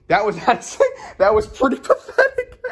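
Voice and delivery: high, cracking up through the words; real laughter breaking up the sentence; laughing. Real, not acted. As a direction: laugh helplessly at yourself while talking. Laughing at own earlier reaction.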